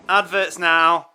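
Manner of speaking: loud, slow, drawn-out; announcer. Acted. Host throwing to adverts, loud and slow. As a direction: announce it like a big station ident.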